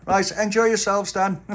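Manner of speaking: high, loud farewell; cheery shopkeeper. A narrator's reading. Joe the shopkeeper seeing the children out, high and loud. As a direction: send them off brightly.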